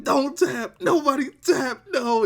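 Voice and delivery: hammed-up high-pitched panic; mock desperate shouting. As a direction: imitate two wrestlers pleading with each other. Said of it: "And they're both like".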